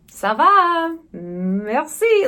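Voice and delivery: lightly voiced French customs man, drawn-out first words; indifferent French official. Customs man to traveller, mock indifferent French. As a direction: imitate a breezy French official.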